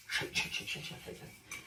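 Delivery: voiceless rhythmic whisper, fading away; whispered shake sounds. Shown as a demonstration. Cook showing how the wok is shaken, whispered rhythmic chant. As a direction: whisper it in time with the shaking.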